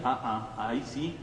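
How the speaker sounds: normal talking voice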